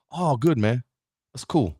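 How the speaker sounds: low, unimpressed friend; half-hearted approval; lukewarm congratulations. Acted. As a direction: answer good news with barely any enthusiasm. Lukewarm friend's answer, contrasted with excited.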